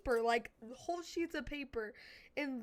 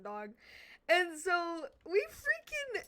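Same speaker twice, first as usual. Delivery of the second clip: ordinary delivery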